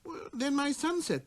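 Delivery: put-on high, wondering child's voice; little prince. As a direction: puzzle it out like a small boy.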